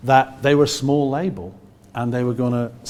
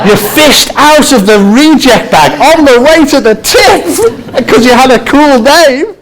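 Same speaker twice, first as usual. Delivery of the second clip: much louder and higher, laughing through it; 12 semitones higher, 17 dB louder, slower than usual. Real, not acted. Telling how the band got signed, loud laughing. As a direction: crack up at the absurdity.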